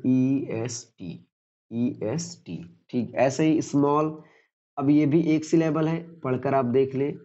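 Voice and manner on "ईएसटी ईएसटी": "EST" letter by letter, drawn out; slow spelling out. Shown as a demonstration. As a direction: spell "EST" slowly, pausing between letters. Teacher spells the suffix for learners. ("EST" एक-एक अक्षर, धीरे-धीरे)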